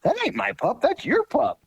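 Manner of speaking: very high mock-amazed insistence; a buddy blurting out his surprise; Joe amazed. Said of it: "He said".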